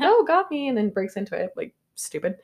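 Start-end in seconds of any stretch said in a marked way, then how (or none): 0.0-0.7 hammed-up caught out. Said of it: "He's like".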